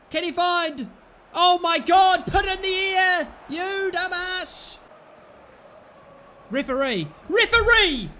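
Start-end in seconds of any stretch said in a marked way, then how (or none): 1.3-4.8 higher and louder, demanding the ball in the air; angry shout. Real, not acted. Yelling at player's pass. 7.3-8.1 higher and louder shout of Referee!; loud appeal. Real, not acted. Calling referee twice.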